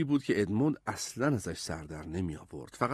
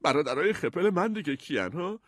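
higher-pitched, miffed question; put-out, nervy challenge; hurt and irritated; 5 semitones higher than usual. A narrator's reading. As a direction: ask it testily, pitch raised. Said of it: "صدای دلخور و عصبی داشت".

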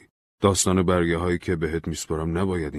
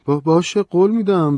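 flustered promise; 12 semitones higher, 10 dB louder than usual. Acted. Stammering agreement, much higher.

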